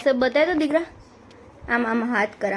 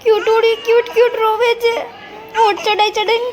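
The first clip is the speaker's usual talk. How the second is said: put-on much higher and louder cooing baby talk; 10 semitones higher, 4 dB louder than usual. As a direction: coo to the crying baby up high. Mother cooing at crying baby.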